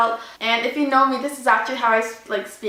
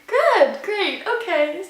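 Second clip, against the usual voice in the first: high, drawn-out retold "okay"; pitched up, putting on acceptance; strained agreeable reply; 7 semitones higher, much slower than usual. Driver answers woman demanding her details, strained and agreeable.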